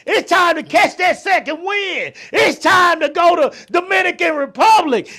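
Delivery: high, loud, hyped-up friend; rowdy party cheer. Acted. Friend urging the others out to party, shouting excitedly. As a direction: whoop it up like you're rallying the group.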